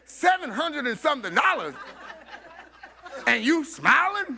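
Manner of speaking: retold question broken by laughter; laughing disbelief. Man beside her laughs in disbelief at her smile, laughing. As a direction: chuckle through the amazed question.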